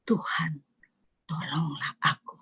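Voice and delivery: breathy whisper for help; whispered plea. Shown as a demonstration. Believer in hard times pleading with God, whispered. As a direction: pray it under your breath. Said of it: "masa-masa yang sulit".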